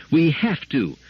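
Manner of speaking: firm, loud insistence; unshaken. Acted. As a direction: state it without budging. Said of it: "was the unshaken response".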